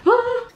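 happy little squeal; excited squeal. Real, not acted. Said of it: "gets this excited".

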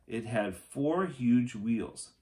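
ordinary delivery

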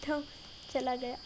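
ordinary delivery

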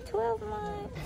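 high, soft coo. Real, not acted. Mother cooing to daughter at check-in, high and soft.

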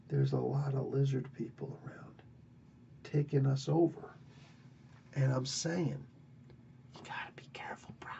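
secretive whisper. Retold or imitated. Brule warns Kull about lizard people, secretive whisper. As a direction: whisper the warning like a conspiracy.

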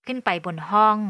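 plain reading voice

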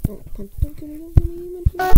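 building to loud high pleading; frantic rising plea; panicked begging. Real, not acted. Begging under attack.

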